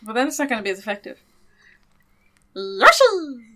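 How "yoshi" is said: high, loud Yoshi call; Yoshi sound. Pretend. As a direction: squeak out the Yoshi cry. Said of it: "You know what sound he makes?".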